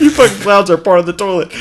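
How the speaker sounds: reading the insult again, half laughing; laughing reread. Shown as a demonstration.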